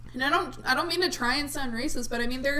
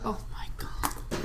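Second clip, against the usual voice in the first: whispered; 6 dB quieter, much slower than usual. Real, not acted. Fond memory of college coworkers.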